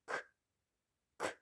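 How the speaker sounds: К as a short voiceless burst, no vowel; isolated K sound. Shown as a demonstration. Teacher modelling the shared sound for children, isolated K. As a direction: pop each К separately, pause between. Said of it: "Який спільний звук?".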